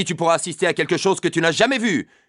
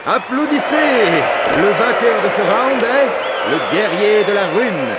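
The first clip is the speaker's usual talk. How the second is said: booming, slow ringside announcement; arena announcer shout; 4 dB louder, slower than usual. Acted. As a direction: call it out to the whole crowd. Arena announcer calls out winner.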